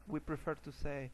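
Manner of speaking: ordinary talk, nothing marked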